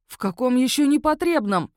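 high, loud, angry retort; growled. A narrator's reading. Werewolf to the magistrate, growling in anger. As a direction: bristle at the accusation. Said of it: "зарычал волкодак".

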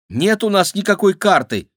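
high, loud protest; declaring loudly. A narrator's reading. Maxim denies having the map, loud high protest. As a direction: insist hotly. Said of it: "заявил Максим".

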